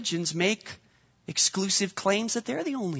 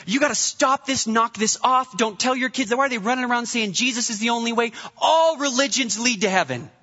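higher, insistent mock rant; a retold stranger's higher, insistent rant at the leader; agitated man; 6 semitones higher than usual. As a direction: imitate the upset stranger pressing his point. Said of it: "He comes up to me as a leader".

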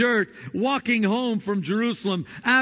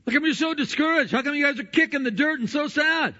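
high, rapid mock-innocent questioning; playful Jesus questioning; 9 semitones higher, faster than usual. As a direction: rattle it off high, playing oblivious. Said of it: "in essence, he said".

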